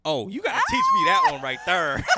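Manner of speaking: high-pitched, loud excited yell; excited shout. Real, not acted. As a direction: shout it out in delighted disbelief. Teasing reaction to accent challenge.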